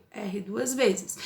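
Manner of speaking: ordinary talk, nothing marked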